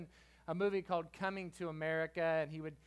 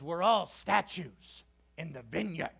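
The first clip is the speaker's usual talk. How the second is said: slow, louder preacher's declamation; pastor impersonation; 6 dB louder, slower than usual. Retold or imitated. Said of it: "like that, you know".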